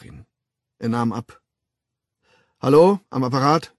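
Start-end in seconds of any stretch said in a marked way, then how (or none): 2.6-3.7 answering the phone. A narrator's reading. Answering the telephone.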